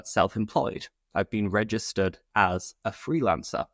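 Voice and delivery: ordinary talk, nothing marked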